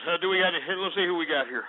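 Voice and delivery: normal talking voice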